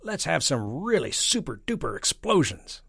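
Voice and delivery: put-on high, loud, childishly excited; eager army chief. A narrator's reading. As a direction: beg for explosions with boyish zeal. Army chief begs for explosions.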